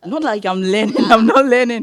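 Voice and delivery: amused, loud denial; laughing. Real, not acted. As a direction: burst out laughing while owning up. Laughingly admits she is not studying.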